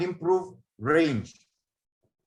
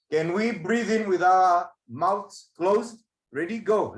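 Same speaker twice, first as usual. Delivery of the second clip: slow instruction; slower than usual. Shown as a demonstration. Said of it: "leads breathing exercise".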